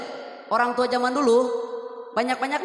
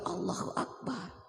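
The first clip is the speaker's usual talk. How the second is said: normal talking voice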